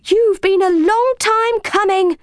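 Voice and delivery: high, cross cat voice; cross meowing cat. A narrator's reading. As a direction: grumble like a put-out cat, pitched high. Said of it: "Ginger meowed crossly".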